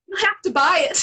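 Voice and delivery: laughing, loud and high; laughing as she tells him to pay; laughing tease. Retold or imitated. Teasing husband.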